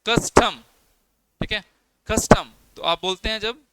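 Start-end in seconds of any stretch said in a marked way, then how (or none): 0.1-0.6 custom loud and higher, कस stressed, टम falling away; stress on first syllable. Shown as a demonstration. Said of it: "टम को बोलने में कोई ज़्यादा जोर नहीं लगा". 2.1-2.5 कस्टम loud on कस, टम unstressed; stress on first syllable. Shown as a demonstration. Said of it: "कस के ऊपर ज़्यादा स्ट्रेस रहता है".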